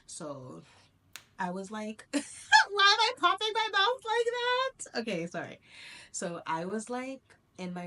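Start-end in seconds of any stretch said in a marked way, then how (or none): 2.2-2.9 sudden high burst of laughter; laughing. Real, not acted. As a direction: crack up loudly at herself. Laughs at her own mouth popping.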